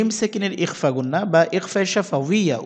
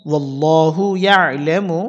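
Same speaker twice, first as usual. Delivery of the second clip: slow melodic chant; slow Quranic reading; recitation; 3 dB louder, slower than usual. Shown as a demonstration. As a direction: draw the words out as in recitation. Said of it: "reads combined word".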